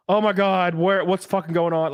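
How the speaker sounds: high, loud, bewildered outburst; mock panic. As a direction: relive being lost and freaked out. Retelling her panicked, high confusion.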